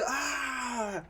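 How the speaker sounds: high yelp of pain; mock pained cry. Limping teammate crying out in pain, high yelp. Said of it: "he's just like".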